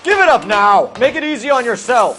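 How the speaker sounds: loud commanding shout. Acted. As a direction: yell up at the roof. Cop yelling at suspect on roof.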